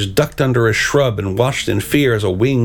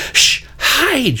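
high-pitched hushed warning; shushing, then a high urgent cry; urgent hiss. A narrator's reading. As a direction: hiss an urgent order to hide. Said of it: "she hissed".

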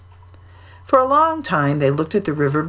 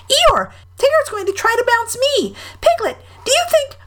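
tiny, high, eager Roo; bursting with eagerness; eagerly. A narrator's reading. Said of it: "said Roo eagerly".

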